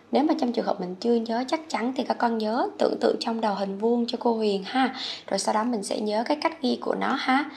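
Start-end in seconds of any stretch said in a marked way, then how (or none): none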